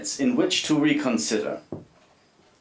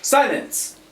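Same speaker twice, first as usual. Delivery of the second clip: barked order to be silent; sharp command; 9 semitones higher than usual. Acted. German captain silencing the prisoners, a sharp barked order. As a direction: cut them off hard.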